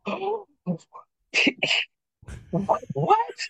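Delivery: high gasping disbelief, with pauses between words; stunned amazement. Retold or imitated. Son opening his first residual check, high-pitched stunned disbelief. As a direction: let the shock send your pitch way up.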